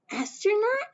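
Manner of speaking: put-on high, wondering child voice; amazed little girl. Young Mae asking her mother if she could be an astronaut, high and amazed. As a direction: gasp at the idea, young and hopeful.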